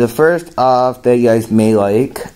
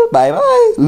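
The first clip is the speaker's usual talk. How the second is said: Woody Woodpecker voice. Acted. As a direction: squawk it like a cartoon woodpecker. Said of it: "Woody Woodpecker's gonna say something".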